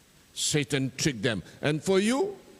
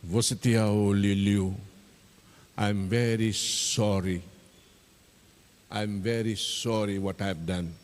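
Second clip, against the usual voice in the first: tearful apology; 8 semitones lower than usual. Acted. Tabale, shivering and crying, apologising to Liu Liu. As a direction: hang your head and say sorry. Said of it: "And he started crying".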